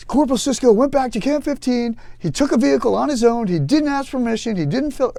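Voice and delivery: high, loud indignant report; tattling lieutenant. Retold or imitated. Lieutenant reporting the corporal to the CO, indignant high complaint. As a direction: rattle off the offences like a tattletale. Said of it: "the lieutenant's like".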